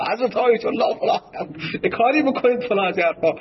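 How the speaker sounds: retold mock yell. A man at the door calls to the ayatollah in a high-pitched mock yell. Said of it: "باز داد زد".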